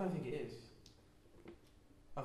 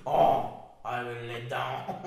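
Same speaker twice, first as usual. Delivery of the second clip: loud, slow mock French accent; 3 semitones lower, 9 dB louder, slower than usual. Showing co-host the impression, slow French accent. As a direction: drawl it loudly in put-on French. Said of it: "you can do a French accent".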